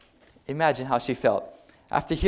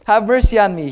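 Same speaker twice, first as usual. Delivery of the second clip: higher, louder desperate cry for mercy; 7 semitones higher, 7 dB louder than usual. Acted. Blind Bartimaeus shouting louder to Jesus for mercy. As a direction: beggar calls out to Jesus over the crowd noise. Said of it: "he said louder".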